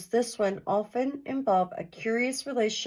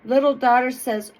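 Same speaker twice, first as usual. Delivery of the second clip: usual speaking voice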